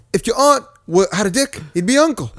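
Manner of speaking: high, mock-earnest Scottish child; Scottish kid. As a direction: imitate a little boy with a Scottish accent. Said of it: "it's a Scottish kid".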